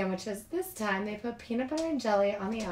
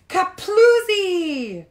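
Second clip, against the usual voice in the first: loud slow burst word; 10 semitones higher, 12 dB louder, much slower than usual. A narrator's reading. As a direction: stretch out kaploozy, loud and high. Balloon bursts in story.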